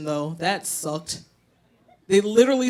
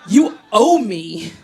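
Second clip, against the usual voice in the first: smug gloating; 3 semitones higher, 7 dB louder, slower than usual. Retold or imitated. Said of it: "I'm like".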